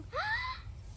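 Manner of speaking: sharp high gasp of shock. Pretend. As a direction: gasp as if robbed of your share. Child sees all the dal gone.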